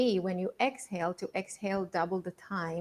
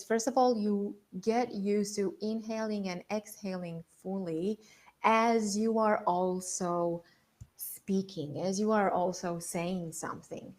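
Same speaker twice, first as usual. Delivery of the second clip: usual speaking voice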